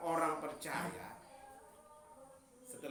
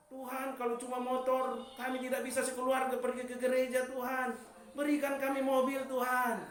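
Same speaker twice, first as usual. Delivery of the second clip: rising, louder sermon voice; preaching; 8 semitones higher, 5 dB louder than usual. Acted. Preacher urging the church to give thanks, loud and rising. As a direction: preach it out to the congregation.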